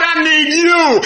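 loud cry of I need you; shouted plea. Acted. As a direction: cry it out to God. Shouted prayer line.

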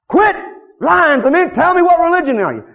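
a hammed-up angry questioner; mock angry demand. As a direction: accuse them of lying. Woman angrily demands answer.

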